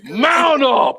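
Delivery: hammed-up gang boss rallying his squad; calling out to his squad. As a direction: summon the crew with a long rallying cry. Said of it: "He called out to his squad".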